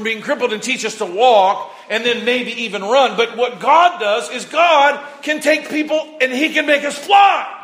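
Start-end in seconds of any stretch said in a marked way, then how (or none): 3.2-7.6 rising preaching shout. Real, not acted. Sermon climax.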